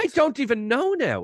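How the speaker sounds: high-pitched confusion; retold bewilderment. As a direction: throw it out in mock bafflement. Retelling bewildered reaction.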